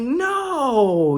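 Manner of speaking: high cry of no. Retold or imitated. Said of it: "I'm like".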